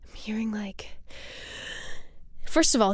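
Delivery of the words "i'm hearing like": hushed whisper while listening. Real, not acted. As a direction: whisper as you strain to hear. Listening to faint clip.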